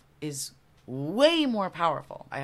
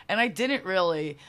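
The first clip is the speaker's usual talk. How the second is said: normal talking voice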